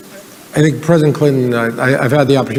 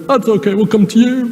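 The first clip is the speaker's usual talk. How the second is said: much higher, easygoing, laughing; Bill Clinton impression; 9 semitones higher than usual. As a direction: shrug it off as Clinton. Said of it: "I can do his voice really well".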